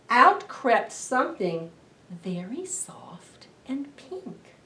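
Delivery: hushed storytelling whisper. Acted. Librarian whispers the surprise to the children. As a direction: whisper it like a secret.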